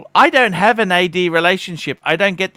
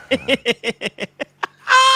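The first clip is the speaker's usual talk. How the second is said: laugh; 6 semitones higher than usual. Real, not acted. Laughing at friend's one-upping quote.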